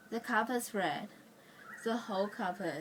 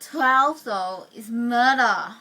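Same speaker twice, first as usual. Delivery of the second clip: "Twelve" and "murder" hit very loud; emphatic dread; 12 dB louder, slower than usual. Acted. Warning about the worst time for the bus, loud dread. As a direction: burst out loudly on "Twelve", drag out "murder".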